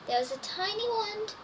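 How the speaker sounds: ordinary delivery, nothing marked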